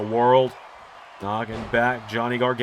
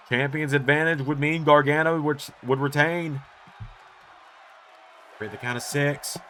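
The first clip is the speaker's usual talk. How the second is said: usual speaking voice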